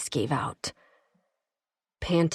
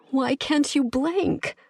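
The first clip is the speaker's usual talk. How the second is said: desperate question; 9 semitones higher, slower than usual. A narrator's reading.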